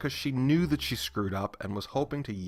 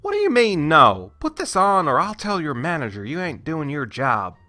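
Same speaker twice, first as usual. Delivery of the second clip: louder, lightly put-on southern accent; fake southern accent; 4 semitones higher, 7 dB louder than usual. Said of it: "my fake southern accent".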